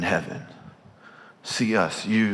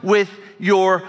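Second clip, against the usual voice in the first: preaching at full force; 9 semitones higher, 3 dB louder than usual. Acted.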